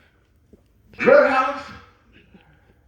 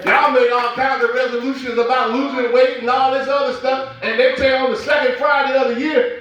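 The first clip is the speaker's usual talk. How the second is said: ordinary talk, nothing marked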